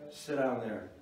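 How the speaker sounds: low, stern order to sit; firm, flat principal's command. Retold or imitated. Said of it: "About that tone of voice".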